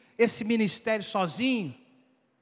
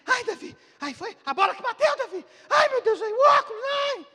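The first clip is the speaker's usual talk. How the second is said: high, loud panicked wailing; panicked mother; 9 dB louder than usual. Retold or imitated. Preacher playing the mother panicking over the broken glasses, high and loud. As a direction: wail over the glasses, hammed-up. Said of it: "Vê, tá".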